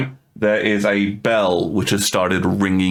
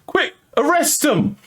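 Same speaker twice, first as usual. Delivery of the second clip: high frantic call for help; yelled plea; slower than usual. Acted. Tiefling yelling at the deputy to arrest them. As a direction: shout up at the deputy, panicked. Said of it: "he just yells".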